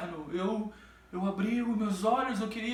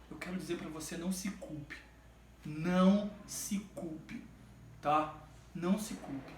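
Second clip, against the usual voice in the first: soft reassurance; 6 semitones lower, 7 dB quieter than usual. Real, not acted. Pastor consoling listeners.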